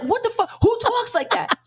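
high, shrill-pitched mock outrage; bursting in outraged. Mom bursting in on her son's game call, high mock outrage. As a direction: burst through the door and blast it high. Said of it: "So I bust through the door".

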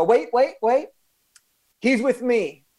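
retelling how he jumped in to stop the elevator; urgent call. Retold or imitated. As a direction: stop him before he steps off.

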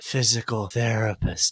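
lightly deadpan mumble; deadpan reply. Retold or imitated. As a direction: drop it dryly and slowly. Deadpan answer to nurse.

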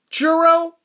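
high, loud questioning echo; puzzled server. Retold or imitated. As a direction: imitate the worker still not getting it. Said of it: "She kept going".

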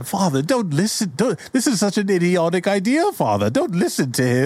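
high, urgent pleading son; hammed-up desperate plea; pleading with Father. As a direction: beg up high to be heard. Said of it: "He tries to plead with Father".

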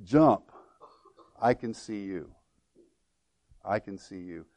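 soft, low reassurance, fading; simple fatherly answer. Retold or imitated. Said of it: "his father answered simply this".

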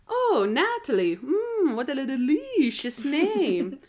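drawn-out, swooping vampire voice; vampire impression. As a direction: savor each word like a hammy vampire. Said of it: "it's impression time".

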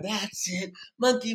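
ordinary talk

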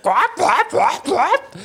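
very high, repeated mock squawk; imitated fight squawks. Mimicking the fighter in action, high squawks. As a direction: squawk out goofy martial-arts noises. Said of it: "He's fighting and he's like".

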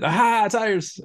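high sarcastic aha; mock eureka. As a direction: ham up the discovery. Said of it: "and that was it".

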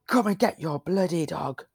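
half-hearted shout of an angry farmer. Retold or imitated. Retelling the farmer on the quad bike, mild mock yell. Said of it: "You should dramatize that a little bit better".

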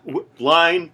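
loud, high call for a line; mock actor flubbing. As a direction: play the flustered actor. Imagining flubbing the scene.

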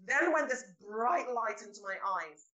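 ordinary delivery

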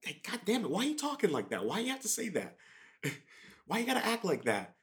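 high-pitched irritated rant; exasperated outburst. Retold or imitated. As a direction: blow up at the nagging relative. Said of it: "we are so quick to overreact".

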